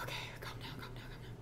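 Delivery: whispered self-calming, barely voiced. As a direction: hush yourself down under your breath. Calming herself at the eye test.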